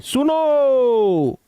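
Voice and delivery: सुनो with the o held long; drawn-out suno. Shown as a demonstration. Teacher stretching सुनो for the kids. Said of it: "तो कितना लंबा खींच दिए हम".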